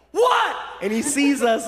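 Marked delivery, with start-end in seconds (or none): 0.1-0.5 high, explosive single word; acted angry what. Man flings hotel door open, explosively irritated. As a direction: burst out, much higher pitched. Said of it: "and he's like".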